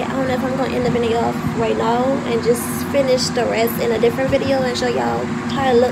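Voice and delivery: loud, fast, so the phone hears; talking loud. Real, not acted. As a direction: raise the voice over the noise. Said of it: "but I'ma talk loud".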